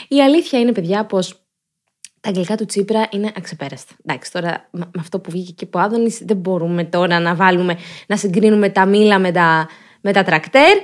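usual speaking voice

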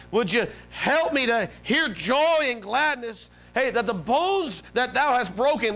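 high, drawn-out plea; impassioned pleading prayer; begging God. Retold or imitated. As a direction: beg earnestly, stretching the key words. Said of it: "he's begging to God".

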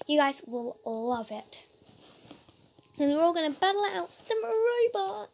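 ordinary talk

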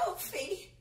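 frightened whisper. Acted. Wife finds the dead cat.

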